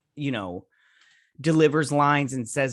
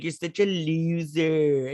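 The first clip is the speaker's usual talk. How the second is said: slow, drawn-out taunting put-on voice; slow sing-song sibling taunt; mocking bratty sister; 4 semitones higher, slower than usual. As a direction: sneer it out like a mean teen sister. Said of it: "And she even did, like".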